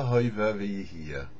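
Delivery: ordinary delivery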